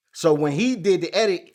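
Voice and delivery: ordinary delivery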